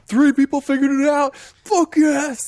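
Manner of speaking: high, loud excited cheer; mock triumph. Filmmakers learning fans spotted their detail, triumphant mock shout. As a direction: yell it like a thrilled nerd.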